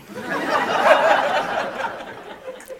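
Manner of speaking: a high-pitched breathy burst of laughter; high airy laugh. Real, not acted. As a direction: laugh out, surprised and tickled. Audience laughs at donkey line.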